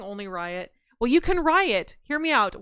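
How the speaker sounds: ordinary delivery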